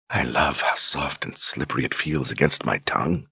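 barely above a whisper, very soft; near whisper. A narrator's reading. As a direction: let it fall to a near whisper. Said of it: "in a little more than a whisper".

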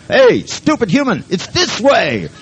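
high, loud dog calling to its dim owner; exasperated dog. Acted. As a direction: yell it like a fed-up hunting dog, drawing out the end. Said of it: "didn't have a dog".

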